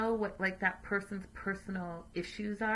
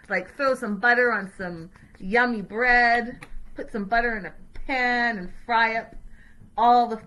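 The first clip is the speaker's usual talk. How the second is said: craving; 7 dB louder than usual. Real, not acted. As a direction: relish every word of the treat. Daydreaming about butter.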